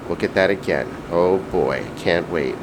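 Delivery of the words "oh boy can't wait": usual speaking voice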